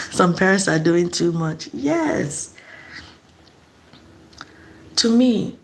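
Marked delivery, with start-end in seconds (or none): none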